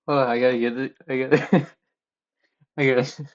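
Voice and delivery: fighting to regain composure mid-giggle; breathless real laughter, words barely getting out; laughing fit. Real, not acted. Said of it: "I gotta pull myself together".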